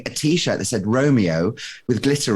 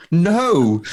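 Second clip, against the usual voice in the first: emphatic no; 6 semitones higher, 5 dB louder than usual. Retold or imitated. Denying at the embassy.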